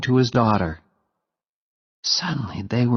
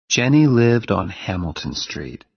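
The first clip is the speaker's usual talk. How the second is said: plain reading voice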